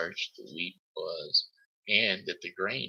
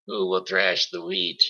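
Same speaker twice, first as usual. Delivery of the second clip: high, louder call out; helpful call; 10 semitones higher, 5 dB louder than usual. A narrator's reading. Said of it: "she called out".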